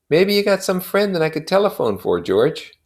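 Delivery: high, pleading friend's voice pitched well up; Michaelis's voice. A narrator's reading. Neighbor pressing grieving Wilson for an answer, high and urgent.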